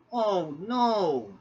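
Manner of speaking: loud, high cry of alarm. Acted. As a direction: cry out in sudden dismay. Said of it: "James cried".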